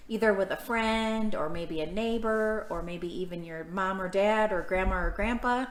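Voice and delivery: ordinary delivery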